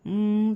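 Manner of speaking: closed-mouth hum. Shown as a demonstration. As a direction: hum with the lips pressed shut. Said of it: "मुंह बंद करके बोल लें". (मुंह बंद करके गुनगुनाना)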